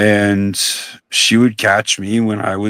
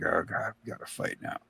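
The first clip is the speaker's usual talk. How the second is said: resigned mutter; 5 semitones lower, 18 dB quieter, faster than usual. Retold or imitated. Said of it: "And I'd be like".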